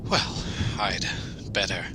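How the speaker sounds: scared, slow, hushed mumble; nervous in the dark; nervous whisper. Acted. Crane alone, frightened in dark.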